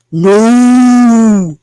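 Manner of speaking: joking booming Jesus refusal to mom; mock Jesus voice. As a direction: thunder it out like a mock prophet. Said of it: "that's my Jesus voice when he says no".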